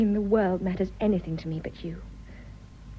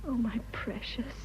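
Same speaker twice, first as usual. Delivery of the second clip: much softer breathy whisper; 11 dB quieter than usual. Acted. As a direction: breathe it tenderly, barely voiced. Tender reply to declaration.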